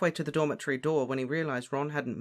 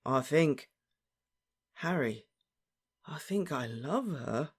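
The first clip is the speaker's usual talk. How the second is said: strangled; slower than usual. A narrator's reading. Ron confesses love to Harry, strangled voice. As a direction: squeeze it out haltingly. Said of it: "said Ron in a strangled voice".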